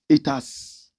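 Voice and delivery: normal talking voice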